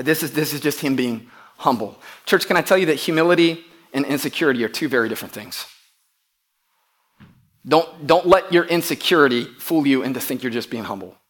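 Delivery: usual speaking voice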